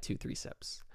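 whispered, a confiding aside. Real, not acted. Admitting to viewers he only had sips, whispered. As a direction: whisper it like a secret.

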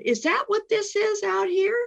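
high, wide-eyed question; awed question rising at the end; retold wonder.

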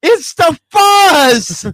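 loud, high, drawn-out alarm; mock paranoid panic. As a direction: yell a hammed-up police warning. Acting out her paranoid panic.

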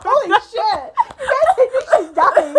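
high, loud laughing; can barely speak for laughing; laughing hard. Real, not acted. Laughing at partner's fit.

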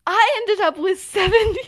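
high, loud boast in imitation; not being quiet. Retelling him boasting of his fortune, loud and unguarded. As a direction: announce it so the whole room turns. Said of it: "he's not really being quiet".

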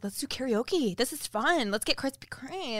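retold excitable urging; eager suggestions. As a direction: talk friends into it, acting out your enthusiasm. Retelling her excited suggestions to friends.

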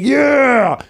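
high excited shout of yeah; cheering yell. Retold or imitated. Dad cheering the game with his daughter, shouting. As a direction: fire up the room with a yell.

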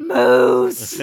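big mock bellow through laughter; mock excited shout. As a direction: bellow it out, teasing someone's false sighting. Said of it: "you were totally like".

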